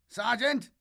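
high, loud radio shout; screaming. Acted. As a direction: scream over the static. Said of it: "Grishin screamed through the static".

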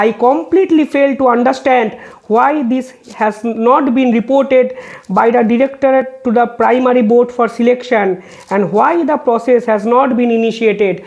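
reading a court order word by word, very slow; slow, measured legal read-out; slow formal reading. Acted. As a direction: spell out each figure deliberately for the record. Quotes judge's observation.

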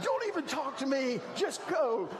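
high, sharp, angry order; father snapping at his kids as they step off the train; angry dismissal. Retold or imitated. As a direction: cut them off, furious and rushed. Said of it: "and I'm like".

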